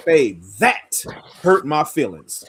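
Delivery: THAT drawn out and hit hard; slow down and lean on "that"; stress on THAT. Shown as a demonstration. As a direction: punch THAT, pausing before the rest. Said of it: "but the inflection".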